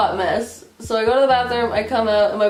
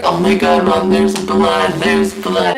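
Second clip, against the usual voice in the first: put-on low, loud, slowed-down drunk voice; drunken panic; 3 semitones lower, 6 dB louder, slower than usual. Drunk daughter alarmed to her mom about blood, low slow panic. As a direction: blurt it out slow, deep and loud.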